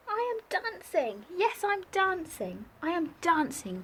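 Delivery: joyful cry. Acted. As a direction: burst out with disbelieving joy. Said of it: "Gerald cried".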